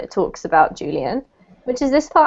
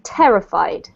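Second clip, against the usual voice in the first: TER in terrified stressed, falling away after it; stress on first syllable; 5 semitones lower than usual. Shown as a demonstration. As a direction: model terrified with the weight on TER. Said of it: "emphasis is actually on this syllable".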